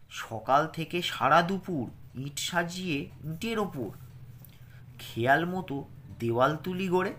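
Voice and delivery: put-on lilting, rhythmic poem reading for children; expressive recital for a class; poem recitation. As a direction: recite it with a gentle sing-song beat.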